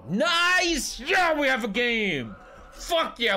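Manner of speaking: high, loud victory shout; excited cheer. Real, not acted. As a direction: cheer like you just won. Game suddenly turns in his favor.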